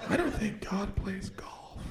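hushed, slow aside; whispered aside. Acted. Comic whispering to the audience about God. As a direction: lean in and whisper it.

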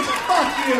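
loud shouted dismissal; angry full-volume kiss-off; furious tirade. Acted. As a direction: end the rant with a yell. End of tirade at waiter.

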